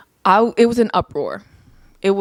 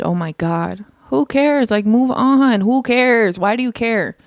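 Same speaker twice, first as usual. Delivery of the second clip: ordinary talk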